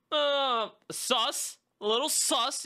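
high-pitched, doubtful reaction; mock suspicion. Host reacting to the lone laugh, mock suspicious. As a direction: draw out a high, suspicious hum, then voice the doubt.

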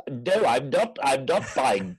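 loud, high indignant outburst; hammed-up outrage of a famous actor; Richard Wilson. Friend mimics the actor on holiday in Italy, indignant impression. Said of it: "I wasn't expecting an impression".

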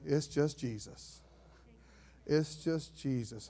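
soft, slow, understated reply; quiet, simple assurance; calm answer. Acted. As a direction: say it gently and unhurried. Voicing the congregation's calm answer.

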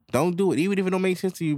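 ordinary talk

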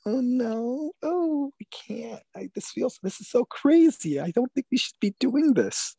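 high falsetto mock of a woman's half-refusal; coy reluctant woman. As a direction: coyly protest in a high, put-on woman's voice.

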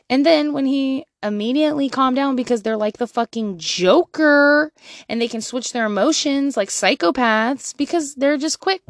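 ordinary delivery

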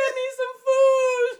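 high, loud fake sob for food; mock crying plea. Begging her pastor for food mid-fast, hammed-up crying. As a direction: whine to the pastor like a starving child. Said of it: "I was like".

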